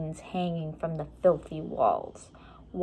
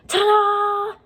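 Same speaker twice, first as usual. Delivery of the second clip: put-on much higher, louder triumphant girl's yell; 7 dB louder than usual. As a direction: flourish it like a magic trick. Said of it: "she yelled".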